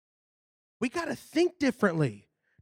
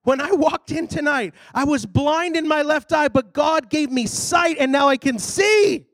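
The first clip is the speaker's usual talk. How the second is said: higher, acted healing testimony building louder; jubilant testimony; 6 semitones higher, 5 dB louder than usual. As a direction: proclaim the miracle like an acted testimony. First healed man testifies jubilantly.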